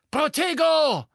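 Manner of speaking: high, loud incantation cry; shouted spell. A narrator's reading. Bellatrix blocking Harry's curse, shouting. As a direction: yell the shield charm.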